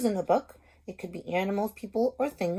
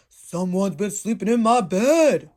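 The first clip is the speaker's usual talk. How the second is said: big bear roar; roaring Daddy Bear; 6 dB louder than usual. Acted. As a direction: boom it out as the big papa bear. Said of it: "roared Daddy Bear".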